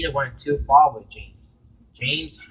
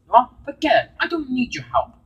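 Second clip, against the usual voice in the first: higher, louder, offended engine; huffy refusal; 12 semitones higher, 6 dB louder than usual. Acted. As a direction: toss the offer back, offended. Gina refuses help in quarrel.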